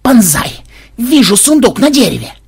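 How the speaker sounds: loud, high excited yell. A narrator's reading. Programmer up a tree to his comrade below, yelling. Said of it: "он завопил".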